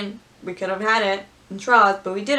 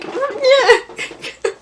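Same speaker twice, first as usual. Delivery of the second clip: high, loud strained comic reply; hammed-up high-pitched yelp; mock Kylo grunt; 7 dB louder than usual. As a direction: squeal it out, laughing. Kylo being pushed, comic.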